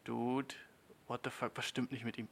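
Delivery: abfälliges Befremden. Acted. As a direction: mutter it, put off by him. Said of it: "beim Mann ist es so". (leises, abfälliges Befremden)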